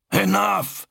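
sudden high-pitched outburst; snapping. A narrator's reading. Zal silences his brother over the vox, snapping. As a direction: snap it out, pitch leaping up. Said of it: "Zal snapped".

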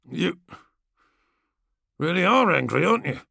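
swaggering gladiator, barely hiding scorn; lightly voiced brash warrior. Kargos taunting.

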